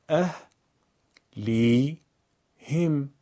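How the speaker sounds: اه، لی، هم as three separate syllables; syllable-by-syllable reading. Shown as a demonstration. Teacher eases a hard word: اه، لی، هم one piece at a time. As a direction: pause after each part: اه، لی، هم. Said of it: "بخش خوانی کنید".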